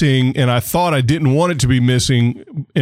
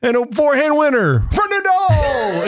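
excited announcer shout, much higher; mock radio commentator call; 14 semitones higher than usual. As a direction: shout the winner like a radio caller. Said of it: "when you say".

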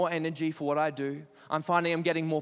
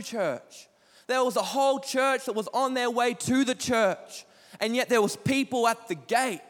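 preaching at full force; 3 semitones higher than usual. Acted. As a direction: preach it, driving each line harder.

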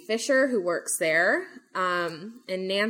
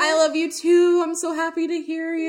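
high, loud mock-weepy wail; imitated tearful crying; 9 semitones higher, 5 dB louder than usual. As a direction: imitate a sister crying happily down the phone. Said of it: "starts crying on the phone".

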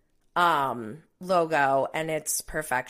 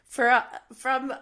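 nervous laugh; 4 semitones higher than usual. Real, not acted. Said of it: "I have a nervous laughter".

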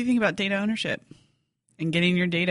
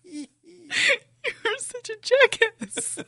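mock outrage; 12 semitones higher, slower than usual. Real, not acted. Laughing at a botched outro.

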